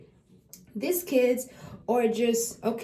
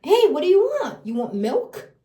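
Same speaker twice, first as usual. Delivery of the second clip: put-on high, louder cheerful hey to baby; bright demo question; 8 semitones higher, 5 dB louder than usual. Shown as a demonstration. Parent showing how to ask baby, bright and cheerful. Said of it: "let me show you".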